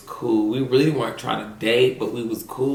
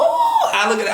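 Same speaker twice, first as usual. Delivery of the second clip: high squeal; 4 dB louder than usual. Retold or imitated. Retold discovery.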